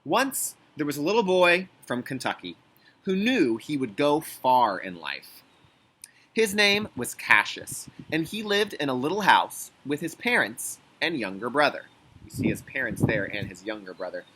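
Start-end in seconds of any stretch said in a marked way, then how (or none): 0.0-0.6 high, bright storybook "Once"; storybook opening. Acted. Reads the picture book aloud. 0.8-11.8 louder, lively storybook reading; reading to children. Acted. Reads the picture book aloud.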